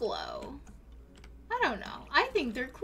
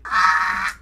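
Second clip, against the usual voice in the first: loud, low burst of a scream; overwhelmed scream; 9 semitones lower, 11 dB louder than usual. Real, not acted. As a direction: scream at how cute it is. Overwhelmed by cuteness.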